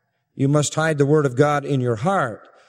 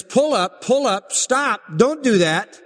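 higher-pitched voice-box alarm, urging a halt; imitated warning voice; 6 semitones higher than usual. Conscience warns like a cockpit voice box, imitated alarm voice. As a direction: repeat it like an automated warning. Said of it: "the voice box is like conscience".

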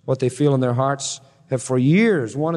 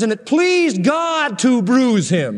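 scripture proclaimed; 7 semitones higher, 3 dB louder, slower than usual. Acted. Quoting Isaiah fifty-three.

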